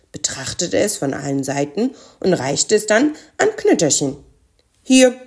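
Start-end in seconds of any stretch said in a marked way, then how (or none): 4.8-5.1 dry, abrupt handover. A narrator's reading. Frost giant handing over a package, dry voice. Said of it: "sagte er mit trockener Stimme".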